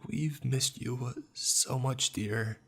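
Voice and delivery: a dead mother aching with sorrow; sorrowful mother. A narrator's reading. Mother to her lost son, slow and sorrowful. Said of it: "dripping with pain and sorrow".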